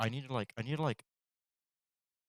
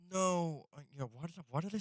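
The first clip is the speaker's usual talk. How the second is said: frustrated no; 9 semitones higher, 10 dB louder than usual. Real, not acted. Frustrated at own mistake.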